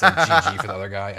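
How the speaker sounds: words spilling out through laughter; laughing. Real, not acted. Joking about annoying players.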